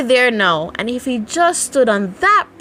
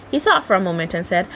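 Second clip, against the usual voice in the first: ordinary delivery, nothing marked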